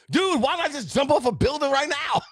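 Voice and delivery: high sarcastic dude voice; exasperated mock. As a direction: toss it off like a fed-up buddy. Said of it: "It's like".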